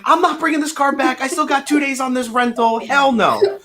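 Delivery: high, defiant, laughing at the end; high mock-defiant son breaking into a laugh; defiant refusal. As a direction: flatly refuse your father. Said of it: "I'm like".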